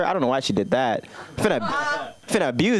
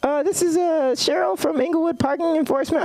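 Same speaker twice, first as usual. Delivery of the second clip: high polite clerk voice; Cheryl's voice; 11 semitones higher than usual. Retold or imitated. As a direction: play the friendly parking officer. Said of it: "She's like".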